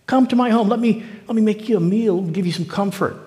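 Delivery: put-on old prophet sweet-talking the young prophet, coaxing and high. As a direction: lure him home with a honeyed offer. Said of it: "And the old prophet said".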